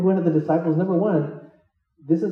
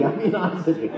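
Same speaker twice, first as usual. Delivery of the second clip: ordinary talk, nothing marked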